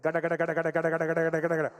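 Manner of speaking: rapid loud "gada gada" engine knock; fast loud sputtering engine imitation; engine sputter noise. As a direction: rattle it out like a dying engine. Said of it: "the engine went".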